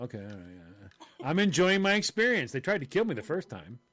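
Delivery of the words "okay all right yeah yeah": meek agreement. Retold or imitated.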